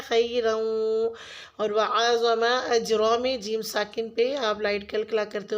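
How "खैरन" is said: खैरन stretched out very slowly, tanween merged; slow demo. Shown as a demonstration. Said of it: "वाव तशदीद से मिला देना है". (खैरन को बहुत धीरे खींचकर)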